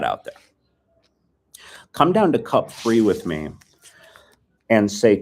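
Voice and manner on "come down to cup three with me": usual speaking voice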